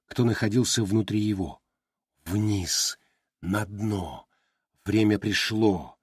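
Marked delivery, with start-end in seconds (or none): 2.2-6.0 lower, softer inner voice; thought voice. A narrator's reading. Glimung thinking the command, soft and low. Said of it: "подумал Глимунг".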